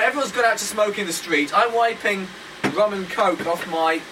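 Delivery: ordinary talk, nothing marked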